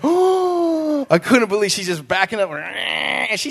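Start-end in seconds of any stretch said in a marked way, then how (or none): 0.0-1.1 high gasping oh of disbelief; a retold jolt of shock. Retelling seeing his sister rip the car, shocked.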